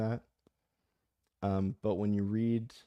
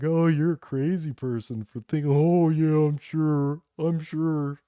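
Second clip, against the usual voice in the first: loud, higher mock scoffing; mocking dismissal; 7 semitones higher, 8 dB louder than usual. Mimicking elites brushing off a doubter, loud and mocking. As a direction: scoff loudly, sing out fake agreement. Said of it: "best tactic is to be like".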